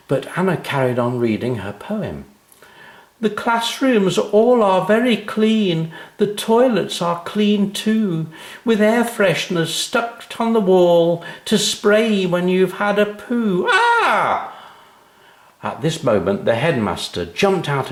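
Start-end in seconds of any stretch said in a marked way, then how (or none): 3.2-13.7 a schoolgirl proudly reciting her poem; little girl reciting. A narrator's reading. Anna keeps reciting her poem. 13.7-14.5 high shrieked shocked "ah"; a sudden yelp of horror; shocked cry. A narrator's reading.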